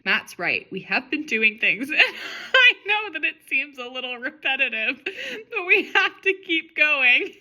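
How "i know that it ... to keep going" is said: high, laugh-choked plea, slowing at the end; helpless giggly delivery; laughing while reading. Real, not acted. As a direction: read on through real laughter, voice climbing. Cracking up while reading own repetitive story.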